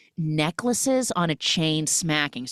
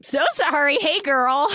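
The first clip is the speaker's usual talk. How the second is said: flustered apology; 11 semitones higher, 3 dB louder than usual. Retold or imitated. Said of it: "She's like".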